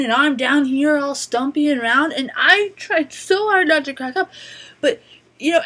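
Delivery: hammed-up little old lady griping about the mannequins; [name] ranting.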